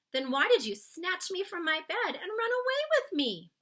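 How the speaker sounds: put-on a curious little girl questioning the giant; Sophie's voice. Girl asking the giant why he snatched her.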